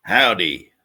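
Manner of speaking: loud, put-on American howdy; hammed-up American accent. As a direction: greet like a mock American. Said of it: "with this accent".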